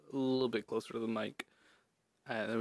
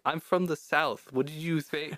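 slower, higher southern retort; southern accent; 5 semitones higher, 3 dB louder, slower than usual. Retold or imitated. Telling friends where they come from, southern accent. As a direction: play up a light southern accent. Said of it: "talking to my friends in a southern accent".

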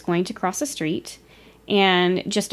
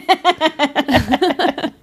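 embarrassed laugh; 7 semitones higher, 4 dB louder than usual. Real, not acted. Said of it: "don't you hate it whenever things just roll out".

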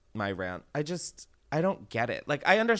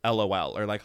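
ordinary delivery

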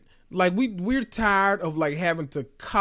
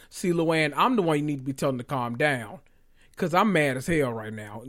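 ordinary talk

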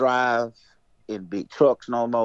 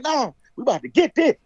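worked-up retort; 12 semitones higher, 3 dB louder than usual. Retold or imitated. Husband argues back in retold panic.

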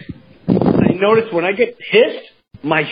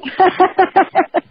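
high laughing voice; laughing hard; 13 semitones higher, slower than usual. Real, not acted. Host laughs telling the co-host about his niece, laughing. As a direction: talk through real laughter.

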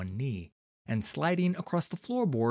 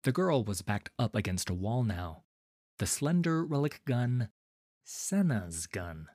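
ordinary delivery

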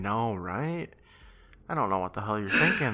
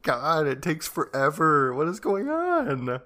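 drawn-out, higher-pitched complaint; mock exasperation; 6 semitones higher, slower than usual. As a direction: groan it out slowly, voice climbing as if fed up. Reacts to drawn-out corn eating.

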